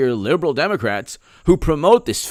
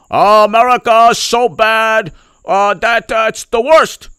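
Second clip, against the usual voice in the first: high, loud hammed-up lament; mock politician; 7 semitones higher, 7 dB louder, slower than usual. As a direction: ham up the disgust. Mocking Cuomo on America.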